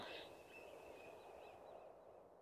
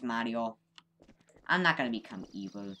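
ordinary delivery

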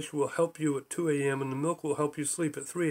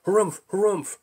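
pompous harumph; 5 semitones higher, 6 dB louder than usual. Acted.

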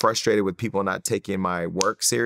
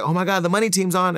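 much higher, squealing fan excitement; mock excited fans; 10 semitones higher than usual. Imitating Ustream fans spotting the money team, high and thrilled. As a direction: gush like a starstruck fan. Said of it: "they were like".

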